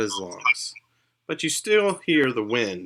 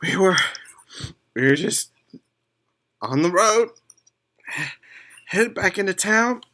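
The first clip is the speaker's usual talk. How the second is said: higher, hurried voice of a shaken elf; frightened elf; 10 semitones higher, 4 dB louder than usual. Acted. Elf recounting the attack to the party, high and shaken. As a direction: tell it like you barely escaped.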